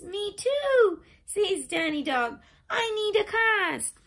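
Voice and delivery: high, loud eager little dog; high excited little dog; eager child voice. Acted. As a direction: beg eagerly for a cast.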